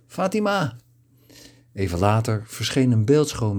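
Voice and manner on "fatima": high, ringing call across the house; calling out a name. Acted. Father calls his daughter Fatima into the room, ringing call. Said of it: "Hij klapte in zijn handen en riep".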